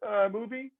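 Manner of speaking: high, coaxing agent voice; imitating the agents. Agents on the phone reminding him, high coaxing voice. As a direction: jog his memory like a pushy agent.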